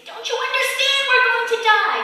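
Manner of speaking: high, loud frantic yell; frantic castaway yelling; panicked shout. Acted. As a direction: shout it at the calm man in panic. Panicked man shouting at calm one.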